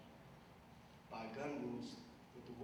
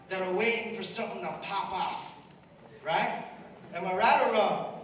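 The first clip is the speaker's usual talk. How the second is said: put-on louder, higher, rousing speech to the kids; preaching at full force; 8 semitones higher, 11 dB louder than usual. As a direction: fire up the young crowd, building. Preaching to young audience.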